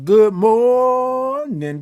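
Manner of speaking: high, long-held "morning", stretched on the first syllable; big high stretched-out morning greeting; drawn-out greeting. Real, not acted. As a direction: sing out "good morning", holding "mor-" very long. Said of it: "I love all them O's and R's and I N Gs".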